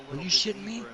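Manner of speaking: high-pitched incredulous question; acted-out exasperation; exasperated disbelief. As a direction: imitate someone who can't believe it. Retold guy without phone service.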